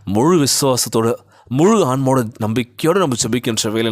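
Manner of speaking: usual speaking voice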